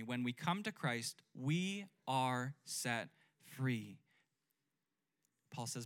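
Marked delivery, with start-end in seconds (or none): 0.0-4.0 slow congregational repeat. Shown as a demonstration. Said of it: "Say that with me".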